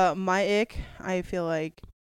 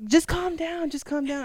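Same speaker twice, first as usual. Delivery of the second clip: high-pitched mimicked "calm down"; retold calming plea; 9 semitones higher than usual. Retelling partner's reply in an argument, mock calming plea. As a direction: imitate a partner's pitched-up plea, easing off in volume.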